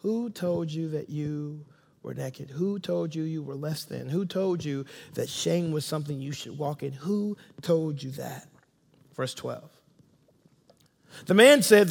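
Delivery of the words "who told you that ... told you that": grieving father, softly asking who hurt them; quivering voice. Acted. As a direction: ask gently as if your voice might break. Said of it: "the quiver in his voice".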